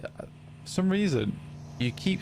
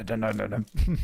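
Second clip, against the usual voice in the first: zombie; 2 semitones lower, faster than usual. Retold or imitated. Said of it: "That was my zombie impression".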